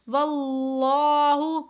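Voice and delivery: वल्लाहु with a thick, full लाम, slowly chanted; heavy lam. Shown as a demonstration. Teacher answering her own question, slow heavy-lam recitation. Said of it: "कैसे पढ़ेंगे?".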